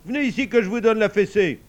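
high, loud threat; scolding at full voice; angry shout. A narrator's reading. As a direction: threaten as a furious father. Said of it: "cria Papa Saïd".

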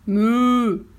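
long, loud moo; cow moo. A narrator's reading. Cow chasing the farmer down the path, loud moo. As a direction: moo it out, sustained. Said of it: "qui meuglaient".

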